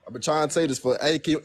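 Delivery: ordinary delivery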